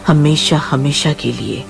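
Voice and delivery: plain reading voice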